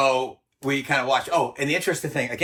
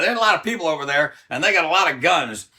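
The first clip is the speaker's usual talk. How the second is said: louder, higher retold call; 5 semitones higher, 6 dB louder than usual. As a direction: holler it down the stairs. Photographer hollers about the guns.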